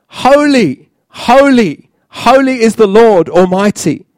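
high, ringing praise call. Acted. Angels calling to one another in praise, calling out. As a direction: call it out as exalted worship. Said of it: "they were calling to one another".

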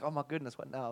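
soft and low, deflated; quiet dismay. Retold or imitated. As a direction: let it drop away quietly. Deflated reaction.

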